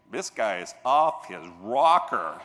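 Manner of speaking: low, slow doubting voice; skeptic's grumble. Retold or imitated. Doubting listener in the audience, a low slow grumble. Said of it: "one percent going".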